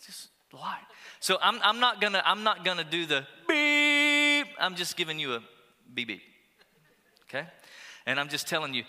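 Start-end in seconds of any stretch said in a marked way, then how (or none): 1.2-4.5 emphatic. Real, not acted.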